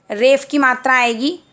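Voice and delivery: normal talking voice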